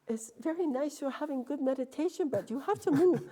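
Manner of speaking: imitating temple attendant. Temple attendant telling the meditator to move, high mock voice. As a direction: play a small man politely shooing her on.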